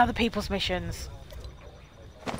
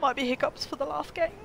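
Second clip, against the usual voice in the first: usual speaking voice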